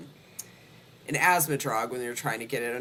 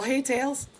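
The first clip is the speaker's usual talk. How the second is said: pet greeting; 11 semitones higher than usual. Real, not acted.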